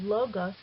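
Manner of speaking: logos stressed on its first syllable, LO-gos; stress on penult. Shown as a demonstration. Teacher showing a two-syllable Greek word, logos stressed on the penult. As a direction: put the weight on LO in logos. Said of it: "the accent is on the penult".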